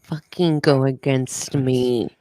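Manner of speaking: ordinary talk, nothing marked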